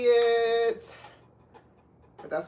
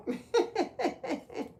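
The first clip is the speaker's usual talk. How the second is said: light, high-pitched giggle; 11 semitones higher, 3 dB quieter than usual. Real, not acted. Host chatting to viewers about shopping, giggling high. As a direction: let out a quick run of high giggles. Amused while pointing out product.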